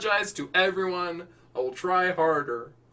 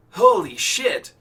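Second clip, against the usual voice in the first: slow, loud, higher awe; stunned; 6 semitones higher, 8 dB louder, slower than usual. Acted. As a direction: drag it out in shock. Stunned Nick.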